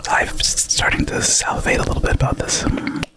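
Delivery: breathy close whisper; ASMR whisper. Acted. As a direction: whisper right into the mic. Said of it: "in A S M R voices".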